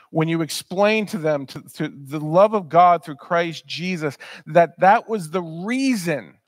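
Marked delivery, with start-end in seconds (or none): none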